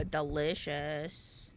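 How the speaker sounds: ordinary talk, nothing marked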